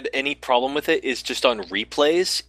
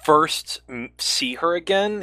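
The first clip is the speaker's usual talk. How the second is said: normal talking voice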